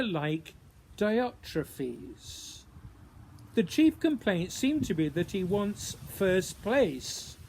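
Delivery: measured, deliberate poetry reading with pauses; poem reading. Acted. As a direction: read it like a puzzled investigator piecing together clues. Reads own poem aloud after introduction.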